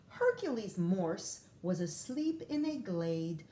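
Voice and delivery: usual speaking voice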